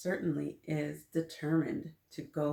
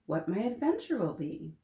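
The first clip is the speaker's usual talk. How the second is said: usual speaking voice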